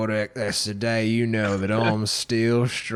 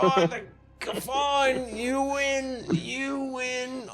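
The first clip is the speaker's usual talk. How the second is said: defeated grumble; 12 semitones higher, much slower than usual. Acted. Beaten NPC concedes.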